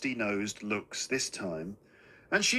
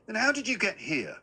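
mother's high-pitched, sceptical challenge; disbelieving, haughty query; suspicious questioning; 9 semitones higher than usual. A narrator's reading. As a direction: question him sharply, pitched well up.

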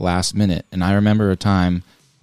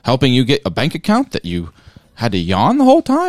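high, louder mock of a scolding mother; imitating appalled mom; 3 semitones higher, 3 dB louder than usual. Retelling how mom scolded her, hammed-up shock. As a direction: ham up the mom's outrage, climbing high. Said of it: "She was like".

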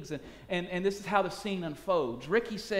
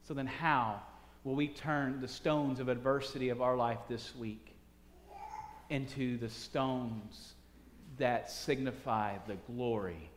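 reflective sermon close; 3 semitones lower than usual. Real, not acted. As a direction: lay it down slowly and gently. Slow preaching close.

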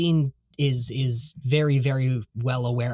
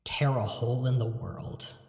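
ominous narration; 2 semitones lower, 5 dB quieter than usual. Acted. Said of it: "as the narration says".

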